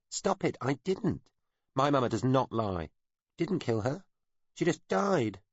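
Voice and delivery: put-on high child voice, defensive and near tears; a small boy pushing back against the accusation; upset child denying. A narrator's reading.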